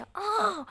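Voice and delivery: high, loud furious shriek; fed up with everyone, shrieking; scream of frustration. Acted. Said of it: "ऐसे चिल्लाती है".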